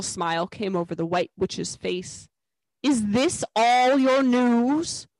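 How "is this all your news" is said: menacing witch. A narrator's reading. Witch coldly demands more news.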